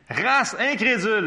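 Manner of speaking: displeased Jesus. Acted. Jesus rebuking the faithless crowd, displeased. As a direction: scold them, loud and slow. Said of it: "Il était pas content".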